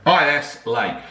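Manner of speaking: usual speaking voice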